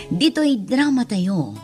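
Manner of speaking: normal talking voice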